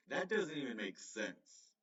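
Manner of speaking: normal talking voice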